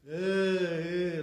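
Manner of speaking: lax, loud, held EH sound; not-so-high E. Shown as a demonstration. Teacher showing the Iraqi chirik, held open eh. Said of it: "not so high like that".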